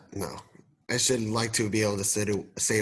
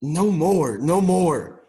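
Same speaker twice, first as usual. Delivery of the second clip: higher, louder, pleading refusal; desperate plea; 5 semitones higher, 3 dB louder, slower than usual. Acted. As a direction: beg the spirit to stop. Scrooge refuses the vision.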